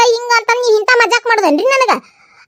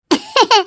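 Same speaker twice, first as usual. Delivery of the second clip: short falling chuckle; brief knowing laugh; 4 semitones higher than usual. Acted. Schemer laughs before addressing in-law.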